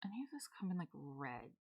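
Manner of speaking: unvoiced whisper to herself. Real, not acted. A streamer searching for red pieces, whispering. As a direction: whisper it to yourself while browsing. Muttering while building.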